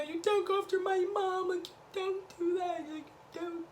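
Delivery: high, soft, teary plea; lightly imitating her crying; mock crying. Candace crying about her mom, high and soft mock tearful. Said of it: "Candace gets to crying".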